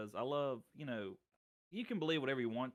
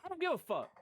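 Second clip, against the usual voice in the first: defiant outburst; 5 dB louder than usual. Real, not acted. Defiant retort to critics.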